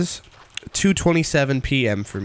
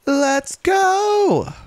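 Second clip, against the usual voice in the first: rising hype cheer. Real, not acted. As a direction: stretch the go way up. Celebration rises.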